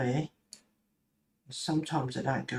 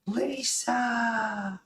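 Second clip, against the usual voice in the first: eerie calling; 9 semitones higher than usual. Pretend. Calling eerily.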